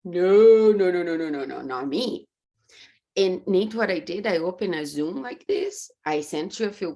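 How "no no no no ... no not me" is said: loud refusal fading; refusing. Retold or imitated. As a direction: push it away, then shrink. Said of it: "fight against".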